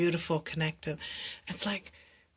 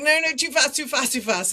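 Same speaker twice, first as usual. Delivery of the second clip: panicked protest; 9 semitones higher, 7 dB louder than usual. Retold or imitated. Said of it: "And I'm like".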